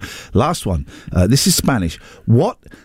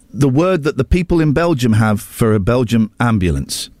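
usual speaking voice

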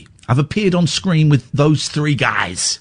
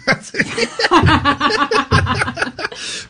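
big laugh; 9 semitones higher than usual. Real, not acted. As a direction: crack up at your own punchline. Laughing at own joke.

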